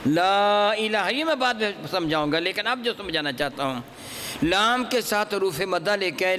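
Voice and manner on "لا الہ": لا الہ with لا stretched slowly; long madd. Shown as a demonstration. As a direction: hold لا for several beats. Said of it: "دو سے چار الف کے برابر لمبا کیا جا سکتا". (مد منفصل کے ساتھ لمبا)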